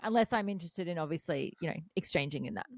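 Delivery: usual speaking voice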